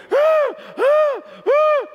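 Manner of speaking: loud, very high wailing cries; imitated wounded-animal wail. Preacher imitating the flailing boy held down, wounded-animal wail. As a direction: mimic a hurt animal's repeated yelps. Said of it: "crying like a wounded animal".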